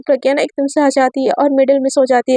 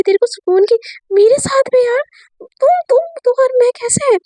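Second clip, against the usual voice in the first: much higher, rising squeaky disbelief; exasperated squeal; 10 semitones higher than usual. Retold or imitated. Peehu blurts to her friend, a high flustered squeal. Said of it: "मतलब पीहू ऐसे बोलती है".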